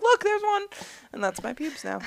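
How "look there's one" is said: put-on teen boy. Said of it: "fourteen-year-old boy".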